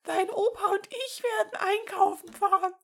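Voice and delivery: tolerate no objection, grandma style; firm grandma voice. Acted. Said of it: "mit einem Unterton, der keine Widerrede duldete".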